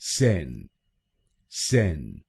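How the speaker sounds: सेन as the stressed syllable; syllable drill. Shown as a demonstration. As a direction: isolate सेन slowly and clearly. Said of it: "दूसरे शब्दांश को यानी सीईएन हम बोलेंगे".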